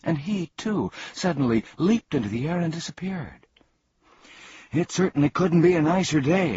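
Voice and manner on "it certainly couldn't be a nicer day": cheerful. Acted. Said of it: "agreed Milo".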